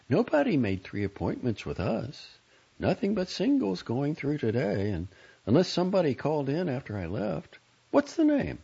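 high, lightly insistent receptionist; receptionist character. Acted. Voices a woman receptionist character.

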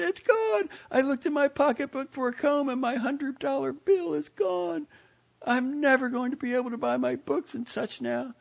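high, loud panicked cry; she's just found the money gone; sister's high panicked wail over lost money; distraught girl. A narrator's reading. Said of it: "there came a terrible shriek".

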